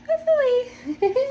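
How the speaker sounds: very high, drawn-out singsong; playful high-pitched squeal of hope; squeaky hopeful. Real, not acted. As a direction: say it in a comically high little voice, slowly. Jokey repeat of hopefully, then laughs.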